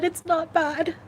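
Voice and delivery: steadier but still high and teary; tearful. Real, not acted. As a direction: pull yourself together mid-cry. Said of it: "without crying".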